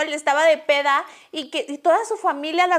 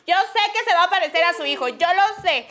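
laughing; 5 semitones higher, 3 dB louder than usual. Real, not acted. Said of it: "laughing while teasing".

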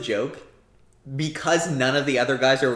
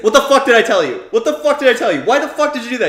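higher, louder mock De Niro rant; retold angry yell; 8 semitones higher, 9 dB louder, faster than usual. Retelling De Niro raging at his crew, imitated angry yell. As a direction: bark it like a furious gangster boss.

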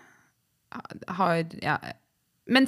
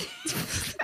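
high, airless embarrassed giggle; real breathy laugh; 12 dB quieter than usual. Real, not acted. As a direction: giggle at your own slip. Embarrassed laugh after slip.

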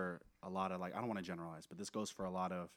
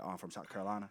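lightly imitated South Carolina accent. Retelling Tree saying where he's from, South Carolina accent. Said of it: "where you from".

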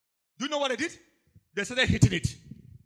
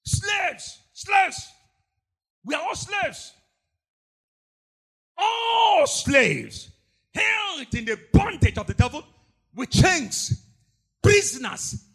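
loud, higher fiery preaching; preaching at full force; 4 semitones higher, 8 dB louder, slower than usual. Real, not acted. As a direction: hammer home the bondage.

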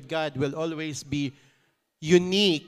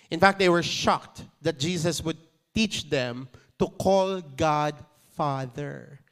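ordinary talk

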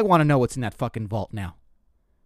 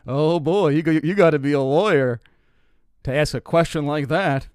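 louder, mock-jovial dad; dad's teasing; 3 semitones higher, 5 dB louder than usual. The dad teasing the comic in the van, mock-jovial and louder. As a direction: rib him like a hearty dad. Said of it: "the dad goes".

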